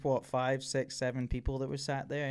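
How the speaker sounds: usual speaking voice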